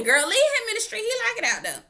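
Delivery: playful exclaim. Real, not acted. Telling her to leave him, playful high sass. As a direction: toss it off laughing. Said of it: "repeating advice".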